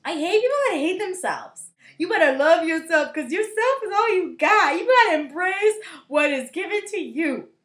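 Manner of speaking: high, loud scolding lecture; worked-up, insistent telling-off; heated rant. Real, not acted. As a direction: rant it emphatically at the listener. Ranting at self-hating people.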